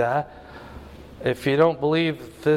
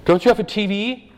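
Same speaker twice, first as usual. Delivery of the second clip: higher teasing question in a put-on voice; mocking modern remark; 7 semitones higher than usual. Preacher imitating what people say about big families, higher teasing voice. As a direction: needle them like a nosy acquaintance. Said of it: "What would we say today?".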